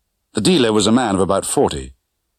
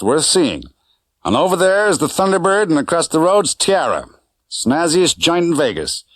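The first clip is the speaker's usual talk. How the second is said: lightly voiced chatty driver, a bit higher; a hint of a breezy Vegas cabbie; driver Correa; 8 semitones higher than usual. A narrator's reading. Driver Correa tour of Strip.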